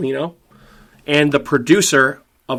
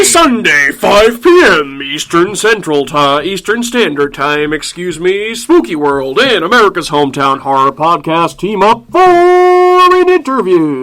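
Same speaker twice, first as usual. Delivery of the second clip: loud, booming monster truck announcer; 4 semitones higher, 7 dB louder, slower than usual. Acted. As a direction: hype it like a rally radio spot.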